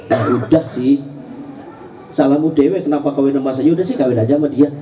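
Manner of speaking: a hint of a tired husband, last line fast; fed-up husband. Husband answers his nagging wife. (suami cuek menjawab istri, suara rendah)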